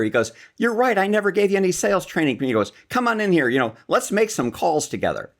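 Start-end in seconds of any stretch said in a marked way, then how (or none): 0.6-2.4 much higher, louder and quick; father's voice. Retold or imitated. Dad voiced, admitting fault. 2.9-5.3 cheerful dad waving him in; father's voice. Retold or imitated. Dad voiced, inviting son.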